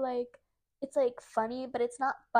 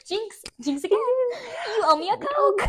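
high, slow, loud and laughing; gleeful jinx call; 8 semitones higher, 4 dB louder than usual. Real, not acted. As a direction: crow it like you won. Calling jinx on her friend.

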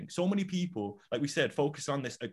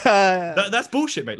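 louder and higher, half laughing; laughing call-out; 6 semitones higher, 8 dB louder, slower than usual. Retold or imitated. Challenging a friend's bullshit claim, loud and laughing. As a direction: call it out loud while cracking up.

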